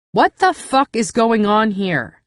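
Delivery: loud, slow, commanding shout; loud demand. Acted. Narrator shouts to silence Cass and Damon, loud slow demand. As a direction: shout to shut them up. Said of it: "loud enough to make them both shut up".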